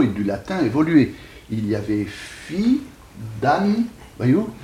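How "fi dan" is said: "fi", a pause, then "dan", each held apart; two separate syllables. Shown as a demonstration. Teacher giving the Latin source of fiant, "fi, dan" syllable by syllable. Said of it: "deux syllabes correspondant à notre actuel fiant".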